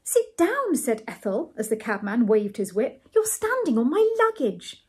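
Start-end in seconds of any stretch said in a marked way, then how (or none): 0.0-0.7 very high-pitched character order; high, sharp command; Ethel scolding. A narrator's reading. Ethel orders him to sit, high scolding voice. 3.1-4.8 high-pitched character complaint; Ethel scolding. A narrator's reading. Ethel objects in the cab, high scolding voice.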